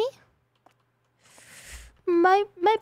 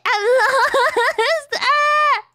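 mock panic laughing; 8 semitones higher, 5 dB louder, much slower than usual.

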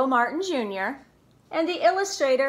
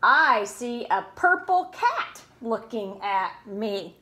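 ordinary delivery

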